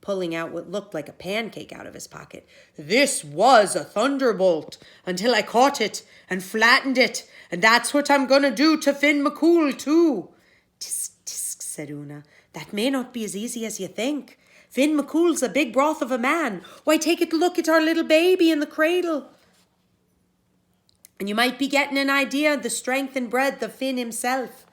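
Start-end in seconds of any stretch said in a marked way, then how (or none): 2.8-10.3 loud, higher threatening giant; a bully promising to crush; menacing giant. Acted. 10.8-11.6 soft, unvoiced tut-tut; a sly little scolding tut; whispered tsk. Una clucking disapproval. 12.5-24.6 higher, louder sly bragging wife; boastful Una. Acted.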